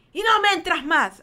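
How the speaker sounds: loud, high final order; angry retold warning. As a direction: lay down the law to an intruder. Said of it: "Yo cerré durísimo esa puerta y dije". (advertencia tajante y enojada)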